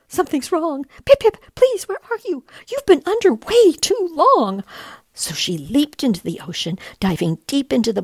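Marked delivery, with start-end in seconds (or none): 0.1-4.6 high, loud panicked calling; a mother calling out in alarm for her lost chick; mother penguin crying out in fear for her chick; frantic cry. Acted. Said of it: "Then she cried out".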